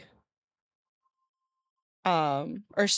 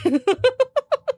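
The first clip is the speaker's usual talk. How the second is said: high giggle; 7 dB louder than usual. Real, not acted. Teasing chat about their fault.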